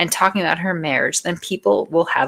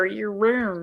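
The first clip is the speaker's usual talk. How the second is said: usual speaking voice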